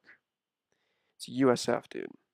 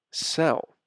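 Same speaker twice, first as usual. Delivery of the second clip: usual speaking voice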